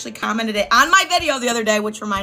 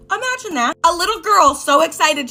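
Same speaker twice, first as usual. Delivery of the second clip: higher; 7 semitones higher, 7 dB louder than usual. Shown as a demonstration.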